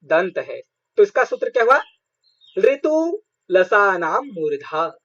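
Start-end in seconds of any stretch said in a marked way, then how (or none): 2.6-5.0 drawn-out, deliberate recital of the rule; slow it right down so students can follow; slow Sanskrit sutra recital; slow sutra recitation. Shown as a demonstration. Teacher recites a grammar sutra.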